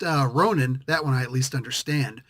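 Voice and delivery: normal talking voice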